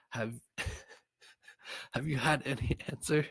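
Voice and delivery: high voice breaking into laughter; laughing. Real, not acted. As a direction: giggle through the line. Sineas, reader laughing.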